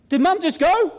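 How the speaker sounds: high, startled daughter; daughter alarmed. Retold or imitated. Daughter asking if her mum just died, high and alarmed. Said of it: "her daughter goes".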